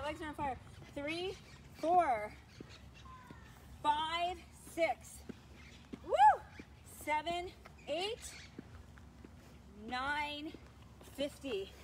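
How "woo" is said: high, loud whoop; high cheering woo. Real, not acted. Cheer during lunge set.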